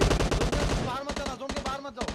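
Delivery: high loud warning; urgent warning shout. Real, not acted. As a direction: shout to keep them in the zone. Warning teammates in game. (ज़ोर से चेतावनी)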